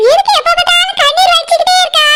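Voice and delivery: high-pitched, exasperated mother's voice; scolding mother. Acted. Mother to her crying bride daughter, scolding. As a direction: chide in a high voice without shouting.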